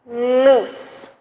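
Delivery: each word said slowly and clearly for the class to repeat; clear model words. Shown as a demonstration. Teacher modelling words for learners to repeat, clearly. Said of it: "model words for repetition".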